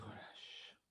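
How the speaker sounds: breathy whisper on the exhale; whispered release. Shown as a demonstration. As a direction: let the word go out as air. Yoga breathing cue, exhale. (relâchement chuchoté)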